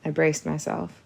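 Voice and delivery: ordinary delivery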